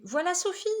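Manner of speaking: high, loud excited exclamation; exclaiming. Acted. Boy announcing the vet's arrival with a high, loud cry. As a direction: cry out at the sound of the engine. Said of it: "Alex s'écrie".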